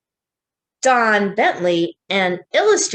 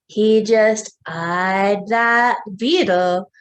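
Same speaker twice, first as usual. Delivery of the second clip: slow, expressive picture-book reading; slow storybook read-aloud; reading to children; much slower than usual. A narrator's reading. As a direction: draw the little ones into the story.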